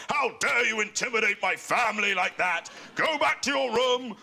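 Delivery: father's outraged bellow, high and hammed-up; imitated angry shout. Son imitates his dad losing it at the German couple, mock furious shout. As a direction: imitate an indignant dad ordering people off, pitched right up. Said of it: "started shouting at them".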